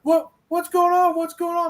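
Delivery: high, puzzled classmates; high confused voice. Retold or imitated. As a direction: ask around high and confused. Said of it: "Everybody was like".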